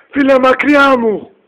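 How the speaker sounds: loud, slow, high yell to keep away; drawn-out yell of disgust; shout. Acted. Friend yelling at the fat guy to back off, loud and slow.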